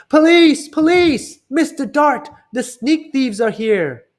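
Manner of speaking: high, very loud alarm yell; Stanley shouting for the police to scare the thieves; loudest shout. Acted. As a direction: scream for the police at full force. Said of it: "he shouted in his loudest, most terrifying voice".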